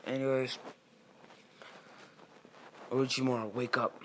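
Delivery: lightly acted wake-up call. Someone rousing Orochimaru, calling out.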